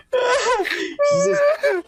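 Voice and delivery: high, squeezed words through laughter; laughing helplessly. Real, not acted. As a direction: try to read on while cracking up. Tries to read on through laughter.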